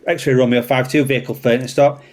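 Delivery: usual speaking voice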